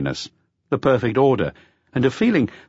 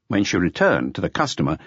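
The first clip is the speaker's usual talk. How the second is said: ordinary talk, nothing marked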